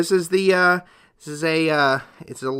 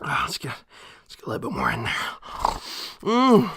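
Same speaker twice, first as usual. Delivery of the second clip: ordinary delivery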